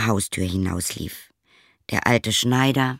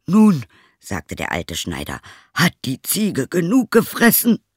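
put-on old tailor asking; 6 semitones higher, 3 dB louder than usual. Old tailor asks son.

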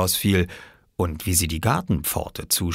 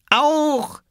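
put-on high, cheerful toddler cry; happy toddler call; 3 dB louder than usual. A narrator's reading. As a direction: chirp it like a delighted little kid. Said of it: "rief er fröhlich".

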